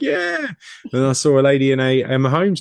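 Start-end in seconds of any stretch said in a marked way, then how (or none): 0.0-0.5 very high cheering reply; excited cheer. Retold or imitated. Fans answering a passer-by, a high excited cheer. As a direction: whoop it back like a fan.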